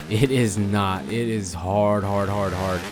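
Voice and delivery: ordinary talk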